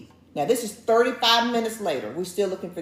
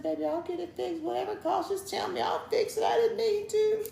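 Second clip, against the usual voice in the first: nervous apology; 11 semitones higher than usual. Retold or imitated. Storyteller promising the wife to fix it, nervously pleading. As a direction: plead small and high to make amends. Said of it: "like, nervous about telling her".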